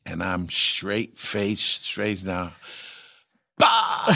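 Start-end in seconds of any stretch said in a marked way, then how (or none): 3.6-4.1 sudden loud laughing outburst, higher; burst of laughter. Retold or imitated. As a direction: explode into a laugh.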